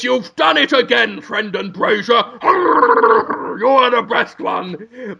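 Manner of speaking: a hint of a goofy Gungan judge; lightly voiced judge praising the lawyer; Boss Nass. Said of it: "It is Boss Nass".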